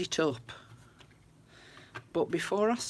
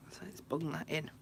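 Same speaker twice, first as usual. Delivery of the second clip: whispered aside; 5 dB quieter than usual. Real, not acted. Whispered aside while crafting.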